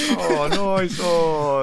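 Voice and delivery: high, loud, drawn-out delight; rowdy catchphrase impression. Replying in the acted film phone call, loud drawn-out impression. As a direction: stretch it out, pitched well up.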